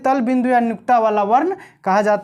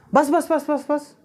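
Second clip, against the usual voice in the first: quick, higher-pitched string of "बस"; rapid repetition; 4 semitones higher, slower than usual. Shown as a demonstration. Teacher demonstrating intonation to students, quick repeated stop. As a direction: rattle off "बस" over and over, higher. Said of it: "स्वर लहरी में उतार चढ़ाव होता है".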